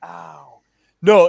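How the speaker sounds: ordinary talk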